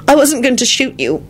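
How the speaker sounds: sudden high-pitched blurt. A narrator's reading. Elizabeth to Lupin about the gun, blurting. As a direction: burst out with it. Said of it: "she blurted out".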